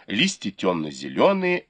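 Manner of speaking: ordinary delivery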